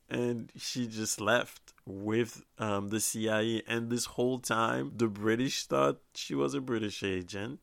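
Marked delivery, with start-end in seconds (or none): none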